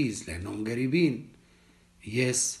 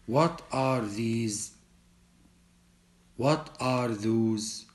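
slow model reading; much slower than usual. Shown as a demonstration. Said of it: "Listen and repeat".

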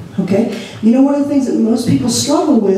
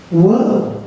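ordinary talk, nothing marked

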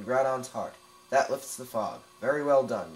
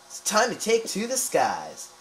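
slow, higher, grand proclamation; slow, lofty reading of "It's time to take to the skies"; dramatic quote read; 8 semitones higher, 6 dB louder, slower than usual. Shown as a demonstration. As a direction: declaim it like an epic tagline. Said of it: "Sounds like a good quote".

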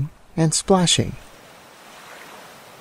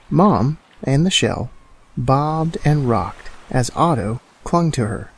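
ordinary delivery

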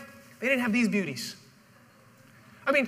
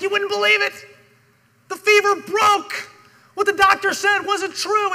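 much higher and louder, bursting with news; excited servants; 8 semitones higher, 7 dB louder than usual. Retold or imitated. Servants run to tell the father, overjoyed and loud. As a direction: servants blurting out the good news. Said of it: "they're so excited".

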